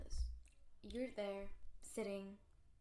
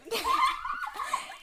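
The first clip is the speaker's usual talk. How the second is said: high shrieking laugh; screaming laugh; 9 dB louder than usual. Real, not acted.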